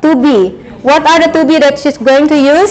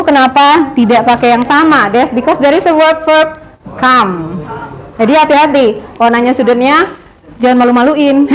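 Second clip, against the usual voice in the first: ordinary talk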